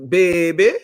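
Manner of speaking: put-on loud New Orleans "baby"; punchy Nola-accented exclamation. Shown as a demonstration. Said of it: "I love the Nola accent".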